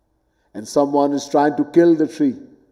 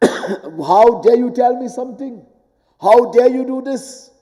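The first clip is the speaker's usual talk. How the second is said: higher, pretend offended "how dare you"; mock indignation; 7 semitones higher than usual. Man insulted by criticism, hammed-up outrage.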